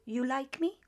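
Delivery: me lifted with a rising question tune. Shown as a demonstration. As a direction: raise the pitch at the end on me. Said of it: "just raise the intonation".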